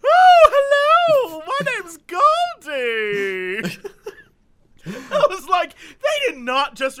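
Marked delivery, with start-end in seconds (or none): 0.0-3.8 very high, loud, very slow mock granny; voice actress mom. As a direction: drag out a shrill fake old-lady hello. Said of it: "a completely different sounding voice".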